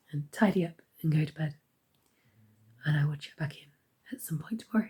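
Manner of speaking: whispery, failing voice; barely any voice left; hoarse whisper. Real, not acted. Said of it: "my voice is failing".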